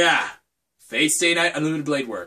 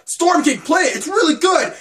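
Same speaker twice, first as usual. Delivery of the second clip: high, loud mock urging; imitating pushy fans; 11 semitones higher, 4 dB louder than usual. Fans pressing Storm King to play a game, high mock excitement. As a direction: hype it up like an insistent fan. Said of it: "they're just like".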